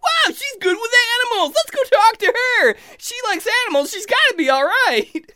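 high, loud, squeaky enthusiasm; wide-eyed high-pitched elf excitement; excited friendly elf. Acted. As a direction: gush like an easily impressed elf. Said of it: "Friendly is like".

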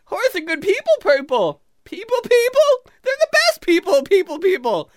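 high, loud squeaky cheer; giddy high-pitched elf babble; friendly drunk elf. Acted. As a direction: chirp like a babbling happy elf. Elf answers back in squeaky voice.

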